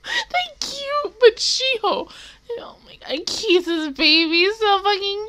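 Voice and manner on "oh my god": awed whisper; hushed, adoring whisper. Real, not acted. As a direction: breathe it out in a whisper. Gushing over baby.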